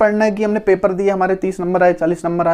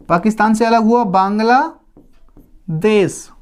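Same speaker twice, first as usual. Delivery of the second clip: slow emphasis; much slower than usual. Shown as a demonstration. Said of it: "teacher stresses key fact".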